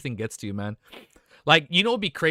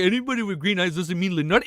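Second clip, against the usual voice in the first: mocking chat; 5 semitones higher, 4 dB louder than usual. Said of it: "You're like".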